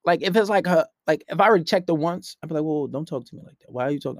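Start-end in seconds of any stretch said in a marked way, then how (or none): 2.6-4.2 soft, low-key objection; staying calm. Retold or imitated. Man checking a girl once, calm and soft. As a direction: calmly set a boundary, voice dropped. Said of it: "I'll stay calm".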